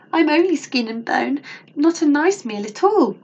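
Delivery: high, pleading grandmother; begging. A narrator's reading. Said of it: "begged Grandmother".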